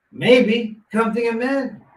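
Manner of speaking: high, loud, animated comeback; retold pushback. Retelling his reply to a panhandler.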